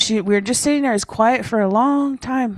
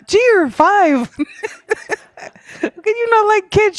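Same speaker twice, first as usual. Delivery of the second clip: laughing disbelief; 12 semitones higher, 3 dB louder than usual. Retold or imitated. Mother laughing at her five-year-old daughter, high and giggly. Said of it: "I was like".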